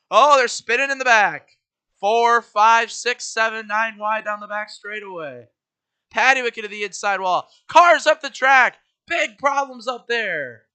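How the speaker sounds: loud, excited wreck call; race commentary. Acted. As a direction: shout the pileup as it happens. Live wreck play-by-play.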